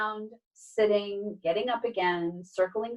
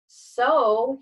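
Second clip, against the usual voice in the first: usual speaking voice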